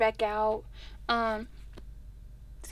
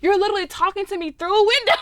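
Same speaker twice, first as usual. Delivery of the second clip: exasperated disbelief; 6 semitones higher, 5 dB louder than usual. Retold or imitated. Retelling yelling girl behind glass.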